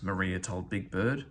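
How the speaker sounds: plain reading voice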